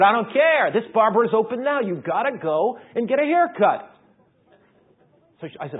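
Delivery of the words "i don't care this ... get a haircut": higher, louder, pushing hard; a wife who won't take no; insistent wife. Retold or imitated. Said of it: "She said".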